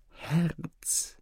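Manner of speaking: high, hushed one-word prompt; soft whisper. A narrator's reading. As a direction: whisper a discreet cue. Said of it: "flüsterte der Wesir leise".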